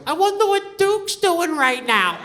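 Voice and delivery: put-on high, slow wistful child voice; daughter. Daughter on the jet ski wondering about the dog, high and slow. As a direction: wonder aloud like a little girl. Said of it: "my daughter's like".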